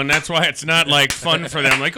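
ordinary delivery

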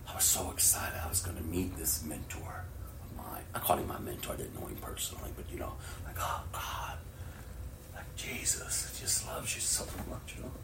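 soft, whispered recollection; quiet whispered storytelling; hushed. Real, not acted. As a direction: drop to a whisper as if remembering.

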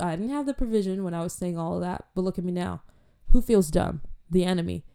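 normal talking voice

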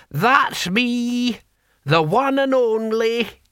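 put-on high, piping, proud old-timer; high, drawn-out gleeful old voice; happy old deaf man. A narrator's reading. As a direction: crow it cheerfully in an old man's voice. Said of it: "said Hamish happily".

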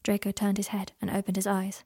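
ordinary delivery, nothing marked

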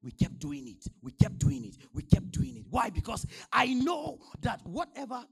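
loud, insistent sermon repetition; forceful preaching. Real, not acted. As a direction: hammer it out loudly to the congregation.

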